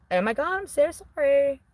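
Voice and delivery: high, put-on Valley Girl accent. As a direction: apologize like a mock Valley Girl. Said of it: "I could do the Valley Girl thing".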